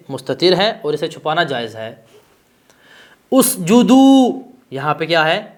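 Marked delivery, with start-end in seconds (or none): 3.3-4.4 اسجدو said slowly, much higher and louder, each syllable stretched; drawn-out example word. Shown as a demonstration. Teacher calls the example word out to the class, اسجدو drawn out high and loud. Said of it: "تلفظ بھی ہو رہا ہے".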